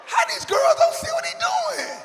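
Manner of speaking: high, loud laughing squeal of disbelief. Real, not acted. Teacher laughing at the dad's many women, high squeal.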